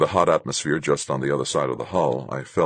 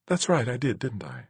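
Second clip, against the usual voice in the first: high woman's voice dropping to a soft tag; rueful smile; 8 semitones higher, 4 dB quieter than usual. Acted. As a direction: concede it with a rueful little smile. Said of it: "hint of a rueful smile".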